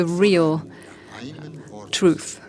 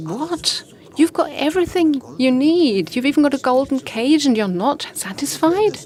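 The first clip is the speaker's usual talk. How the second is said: higher, louder scoffing king; how dare you want more; indignant disbelief; 7 semitones higher, 4 dB louder than usual. Acted. King scolding his caged parrot, indignant.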